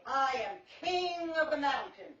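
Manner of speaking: loud, slow proud proclamation; boastful shout. Acted. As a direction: crow it out like a little king. Pig boasting on a rock.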